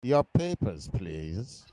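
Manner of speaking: put-on a light German officer accent demanding papers; clipped German guard, lightly done. Friends joking, light German officer accent. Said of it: "I don't think I have a good German accent".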